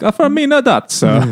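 hammed-up, high-pitched mock Italian. Shown as a demonstration. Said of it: "sounding like Italian stereotypes".

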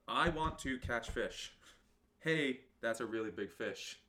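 very slow, deliberate words; slow mock-solemn poem; slow haiku recital.

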